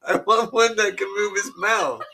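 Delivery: high-pitched, giggly talk through laughter; barely holding the laughter back; laughing hard. Real, not acted. Confessing he now wants a puppet, laughing hard. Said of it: "I shouldn't be laughing this hard".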